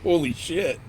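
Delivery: surprised outburst. Real, not acted. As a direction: caught off guard by the speed. Instant jump off the planet.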